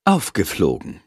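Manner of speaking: usual speaking voice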